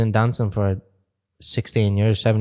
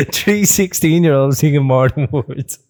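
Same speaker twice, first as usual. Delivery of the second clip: laughing; 3 semitones higher, 3 dB louder than usual. Real, not acted.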